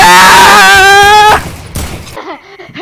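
big burst of laughter; loud laugh. Real, not acted. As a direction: laugh out hard.

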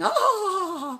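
high laughing shriek; laughing squeal. Retold or imitated. Friend Kayla cracking up over the chat chaos, high laughing shriek. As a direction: burst out in a high, laughing cry. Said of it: "And she's laughing".

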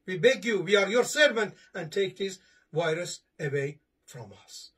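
ordinary delivery

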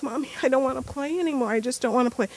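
imitated son begging to stop; whiny kid. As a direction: beg mommy to quit. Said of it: "so he's like".

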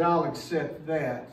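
low, quiet, fading acceptance; a hint of a solemn father accepting; God accepting the sacrifice, softly; lightly voiced God. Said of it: "and he said".

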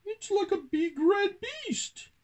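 very high squeaky mouse voice; Pippin amazed. A narrator's reading. Country mouse describing the train, squeaky awe. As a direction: marvel in a high-pitched squeak. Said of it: "gasped Pippin".

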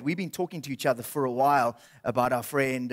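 ordinary delivery

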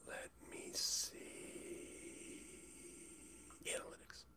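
muttered whisper to himself. Real, not acted. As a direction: whisper while looking for something. Whispering while searching screen.

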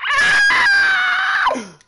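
high piercing scream; startled scream. Real, not acted. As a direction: let out a sudden shriek. Dragged under in game.

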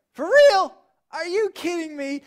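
incredulous. Retold or imitated. A man asked to strike a rock, loudly incredulous. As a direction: blurt it out in disbelief.

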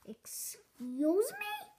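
slow shocked question; disbelieving. Acted. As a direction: stretch it out in disbelief. Disbelief at what she heard.

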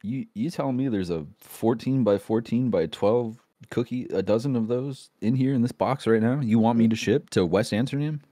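low incredulous postal clerk; suspicious clerk. Acted. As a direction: question it low, in disbelief. Clerk disbelieving huge cookies.

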